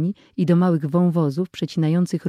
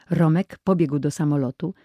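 ordinary delivery

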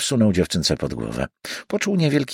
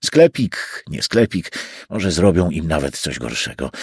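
ordinary delivery, nothing marked

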